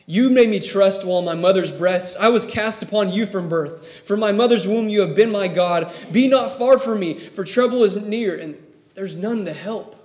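fervent reading of the psalm; scripture reading. Acted. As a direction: proclaim the verse to the congregation. Reads psalm aloud.

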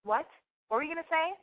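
high, challenging repeated what; confrontational. Retold or imitated. Comedian challenging the date's girl, high and confrontational. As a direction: square up for a fight. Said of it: "and I went".

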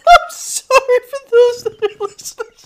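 real laughing fit; crying with laughter. Real, not acted. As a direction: apologize while cracking up. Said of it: "I'm fucking crying".

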